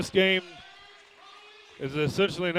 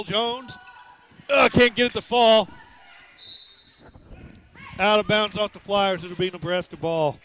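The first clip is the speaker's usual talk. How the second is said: brisk live game call; play-by-play; 3 semitones higher than usual. Real, not acted. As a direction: call the action as it happens.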